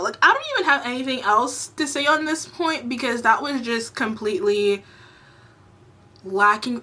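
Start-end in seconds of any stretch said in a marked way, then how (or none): none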